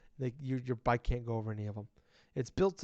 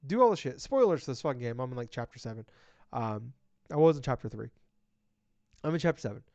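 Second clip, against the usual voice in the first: ordinary talk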